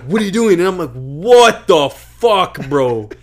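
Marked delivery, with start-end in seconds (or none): 0.0-0.6 sergeant question. Retold or imitated. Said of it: "just be like". 0.9-3.1 slow, loud, stretched-out what the fuck; drawn-out startled cry. Retold or imitated. Retelling seeing the red face, slow loud startled cry. Retold terrified reaction.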